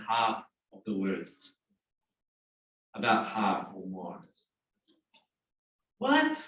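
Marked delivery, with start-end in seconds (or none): 6.0-6.4 mock surprise. Teacher feigns shock at class.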